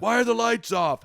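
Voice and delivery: put-on high, loud bewildered old man; confused old man. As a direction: holler it like a baffled old-timer. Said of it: "The old man's like".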